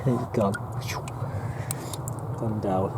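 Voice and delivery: a soft unvoiced sigh of words; whispered. Real, not acted. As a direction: whisper it as the fish vanishes. Goldfish slipped away.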